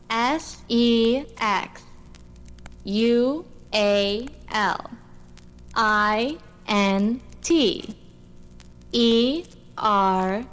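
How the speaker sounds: slow letter-by-letter spelling. Acted. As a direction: spell each letter out slowly. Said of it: "Why did you just spell it and then say it?".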